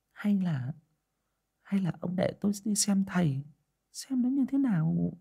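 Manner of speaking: high, hesitant wife's voice; timid old wife. A narrator's reading. Wife proposing to her husband, high and tentative. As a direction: coax him gently toward the fortune teller. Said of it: "đề nghị".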